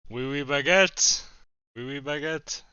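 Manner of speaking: mock French. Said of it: "say something in French for me".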